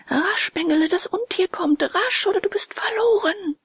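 very high, urgent goat voice; bleating goat. A narrator's reading. Goat warning puppet of the coming monster, bleating urgently. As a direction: cry the warning high and pressing. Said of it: "meckerte die Ziege".

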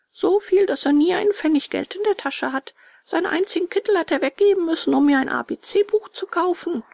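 high-pitched, eager explanation; earnest puppet boy. A narrator's reading. Bengele describes his father's poverty. (hohe, eifrige Kinderfigur)

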